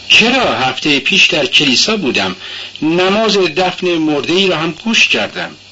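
put-on high, young girl's voice, earnest; young girl protesting she does go to church; timid Sonia. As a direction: answer shyly but eagerly.

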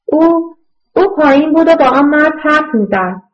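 timid child confessing to his mother, lightly voiced; thin little boy's voice. A narrator's reading. Little boy Zalmai admits his mother talked with that man, thin voice. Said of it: "با صدای نازکی که چندان بلندتر از زمزمه نبود".

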